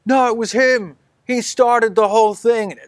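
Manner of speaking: high accusing cry. Acted. Man in the crowd cries out an accusation, high. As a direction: point him out loudly. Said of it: "another man cries out".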